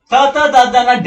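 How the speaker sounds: త, త, ద, ద, న loud, tongue tip on the teeth; dental sounds. Shown as a demonstration. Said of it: "dentals". (త, త, ద, ద, న దంత్యాలుగా, గట్టిగా)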